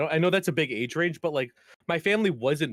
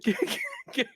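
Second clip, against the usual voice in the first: squeaky mock taunt; 11 semitones higher than usual.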